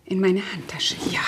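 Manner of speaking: whispered to herself, hiding a letter; hushed whisper so nobody hears. Acted. Annabelle hiding a letter, whispering to herself.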